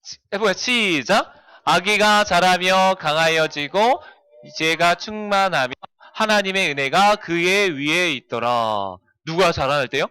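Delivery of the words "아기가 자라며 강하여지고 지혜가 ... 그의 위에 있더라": slow, measured group reading, lower pitch; scripture recitation. Acted. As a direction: lead the children through the verse, drawing out each phrase.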